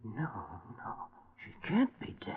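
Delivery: stricken, pleading disbelief; anguished denial. Acted. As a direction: refuse to accept she's gone. Hears daughter is frozen dead.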